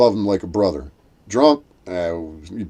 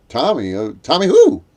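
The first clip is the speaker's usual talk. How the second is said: retold puzzled question, rising high and loud on the name check; baffled, voice shooting up at the end; 8 semitones higher, 8 dB louder than usual. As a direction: act out not placing the name. Puzzled reply to cancer news, retold.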